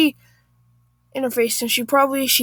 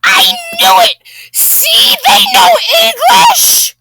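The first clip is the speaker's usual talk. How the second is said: very high, loud Karen shriek; hammed-up, drawn-out screech; screaming; 10 dB louder, slower than usual. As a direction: shriek in vindicated outrage. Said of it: "Karen's eyes bulge as she screams".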